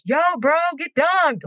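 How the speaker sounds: high-pitched heckle, no real volume; mock yell. Shown as a demonstration. Heckling a baptism from the crowd, mock yell. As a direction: pretend to holler it across a church. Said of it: "just yell in the church".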